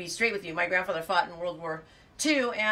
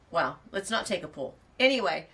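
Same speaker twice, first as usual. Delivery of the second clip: ordinary delivery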